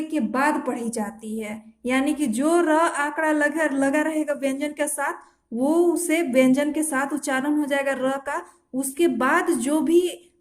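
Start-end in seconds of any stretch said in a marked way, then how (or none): none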